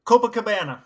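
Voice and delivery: ordinary delivery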